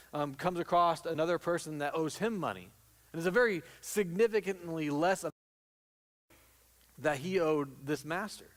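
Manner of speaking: usual speaking voice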